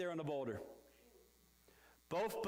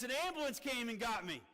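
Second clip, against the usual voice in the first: ordinary talk, nothing marked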